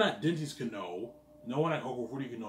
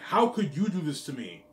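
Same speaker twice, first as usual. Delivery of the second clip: higher, louder whiny mock of her complaint; mocking whine; 5 semitones higher, 9 dB louder than usual. Mocking his girlfriend's whiny accusation, higher and louder. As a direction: mimic her outrage, whining it back at her. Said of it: "I'm like".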